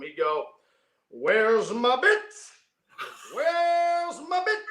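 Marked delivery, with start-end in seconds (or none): 1.1-4.6 slow, high booming call; booming male voice. Retold or imitated. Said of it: "his booming voice walking toward".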